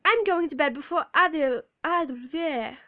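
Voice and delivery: loud British attempt, stumbling at the end; indignant put-on British, tongue tripping; British accent. Shown as a demonstration. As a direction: launch into British and fumble it. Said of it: "Let's try it again".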